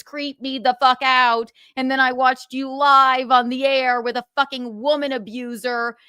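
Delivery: normal talking voice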